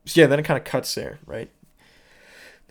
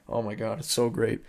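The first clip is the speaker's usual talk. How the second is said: ordinary talk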